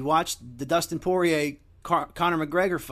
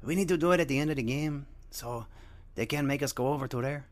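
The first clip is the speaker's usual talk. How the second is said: a lightly put-on Mexican accent, imperfect; mock Mexican fan accent, only lightly done. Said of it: "Not all my accents are perfect".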